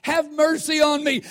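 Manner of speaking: high, drawn-out cry for help; imitating a beggar's loud, pleading cry; crying out loudly. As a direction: call out to Jesus over a crowd, stretching the words. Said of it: "cried out with a loud voice".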